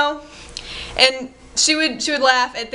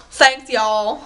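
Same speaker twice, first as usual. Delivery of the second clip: imitating an overly thick Southern drawl; mock Southern twang for attention; put-on Southern accent; 3 dB louder than usual. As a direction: lay on a fake Southern accent. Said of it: "overly thick Southern accent".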